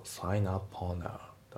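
"サインアップオーナー" in one chain, sai-na-po-na; linked phrase. Shown as a demonstration. Teacher showing learners the whole linked phrase sign up on our, joined. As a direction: join every word of "サインアップオーナー". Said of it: "リンキングでオーナーですね".

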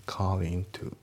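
"calling to" linked as "callin-tu"; "calling to" said without its g; dropped G. Shown as a demonstration. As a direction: leave the g off "calling" and glide into "to". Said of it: "コーリングのGが抜けますね".

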